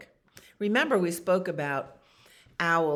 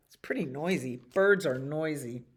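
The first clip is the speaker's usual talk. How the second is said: ordinary talk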